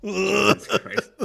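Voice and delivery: slow high stunned exclamation; a long scandalized Jesus Christ; high, slow and aghast; shocked, drawn out. Real, not acted. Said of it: "come to Jesus moment".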